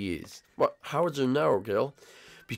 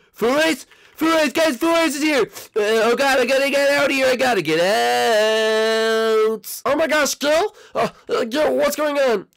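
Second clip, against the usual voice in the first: high, loud cry of alarm; panicked alarm; 11 semitones higher, 4 dB louder, slower than usual. Acted. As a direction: yell out in a panic, pitch way up. Discovers intruder, raises alarm.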